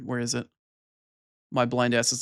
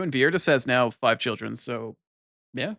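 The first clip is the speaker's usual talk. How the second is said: ordinary talk, nothing marked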